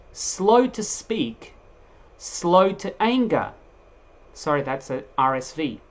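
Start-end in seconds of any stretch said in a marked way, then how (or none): 0.1-3.5 drawn out and much slower, high; slow emphatic reading. Acted. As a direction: linger on each word slowly.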